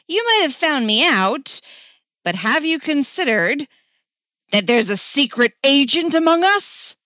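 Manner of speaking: high, loud dramatic reveal; theatrical rebuttal. Acted. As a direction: build a hammy case for the defence. Hammy counter-accusation in the game.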